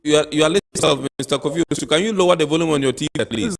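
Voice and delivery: to someone hard of hearing. Real, not acted. Host asking caller over noise.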